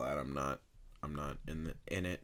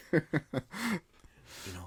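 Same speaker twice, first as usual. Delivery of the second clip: higher with a laugh; 9 semitones higher than usual. Real, not acted. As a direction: chuckle it out. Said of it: "said with laugh".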